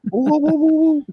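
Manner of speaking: high hooting ape noises; mock monkey hoots. Retelling how Bobby taunted the caged monkey, high hoots. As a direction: hoot like a teasing man mocking a monkey. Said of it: "He just kept going over, going".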